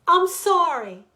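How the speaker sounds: sudden loud high cry. Acted. Wife begging husband not to leave, loud cry. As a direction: cry out the apology. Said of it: "she cried".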